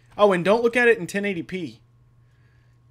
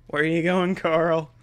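ordinary talk

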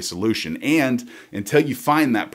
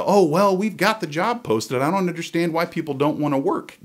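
higher, innocent excuse-making tone; mocking regional boss; 8 semitones higher than usual. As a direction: fake clueless manager shrugging it off. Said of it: "they can play the".

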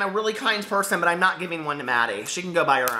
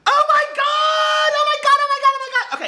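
ecstatic screaming; 5 dB louder than usual. Real, not acted. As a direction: scream like you just won. Opening package, overwhelmed with joy.

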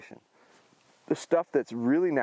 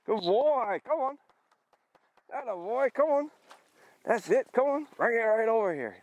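much higher, eager coaxing; excited call; 11 semitones higher than usual. Real, not acted. Trainer calling his pup in, high and excited. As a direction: cheer the dog back in, bubbling.

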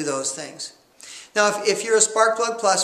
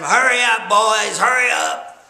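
drawn-out louder yell of a prison boss; boss man yelling; 2 semitones higher, 5 dB louder, slower than usual. Retold or imitated. Prison boss yelling at the shoveling prisoners: slow, loud call. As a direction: bark the order down at the chain gang, slowly. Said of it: "yelling at 'em".

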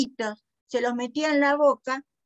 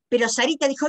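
plain reading voice

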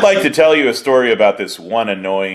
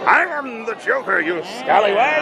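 high, far-off villain voice; hammy Joker voice; 11 semitones higher than usual. Pretend. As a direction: ham it up as a cartoon Joker. Mock Joker voice.

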